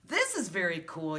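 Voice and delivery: ordinary delivery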